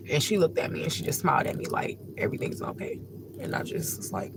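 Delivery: soft, nearly voiceless with real tears; tearful whisper. Real, not acted. Describing the stranger's reassuring smile, tearful whisper. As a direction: whisper it, choked up. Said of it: "I hate when I cry".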